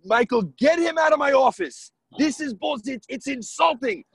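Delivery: high, loud, offended yelling; a seller retold furiously throwing them out; angry outburst. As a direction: blow up at the lowball offer. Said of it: "He was pissed".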